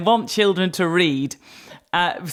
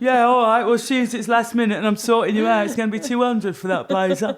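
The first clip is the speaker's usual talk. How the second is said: mock London market seller flogging a blazer; Cockney market trader; 3 semitones higher than usual. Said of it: "I'm in Cockney Market style voice".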